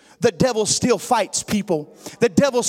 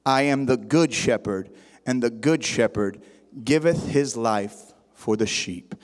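solemn scripture recitation in a low pitch; scripture reading; 8 semitones lower than usual. Acted. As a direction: read the verses out deliberately, pausing between phrases.

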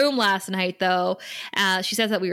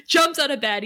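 normal talking voice

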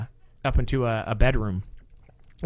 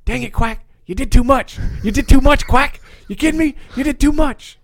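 much higher, louder scolding; exasperated neighbor; 12 semitones higher, 8 dB louder than usual. Retold or imitated. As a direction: chide a friend who went too far. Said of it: "he goes".

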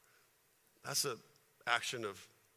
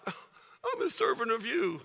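slow, higher sulky whine; mock pouting; 6 semitones higher, slower than usual. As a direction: sulk it out like a hurt servant. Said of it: "Or you can pout".

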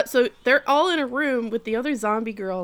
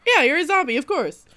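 casual matter-of-fact; 8 semitones higher, 8 dB louder than usual. Retold or imitated. Retelling Kotaro's offhand explanation.

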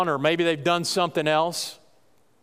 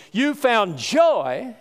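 slow emphatic preaching; 3 semitones higher, much slower than usual. Acted. Preacher drawing out joy.

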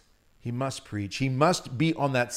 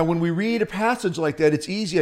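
ordinary talk, nothing marked

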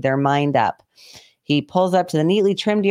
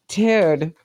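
ordinary talk

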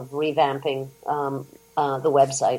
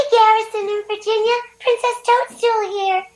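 very high princess voice; cartoon princess; 4 dB louder than usual. Shown as a demonstration. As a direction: greet the fans as the princess. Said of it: "do it in your voice".

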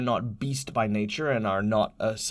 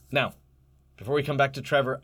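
normal talking voice

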